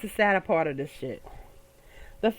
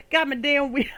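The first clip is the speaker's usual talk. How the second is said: ordinary delivery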